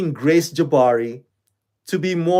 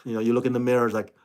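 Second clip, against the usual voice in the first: normal talking voice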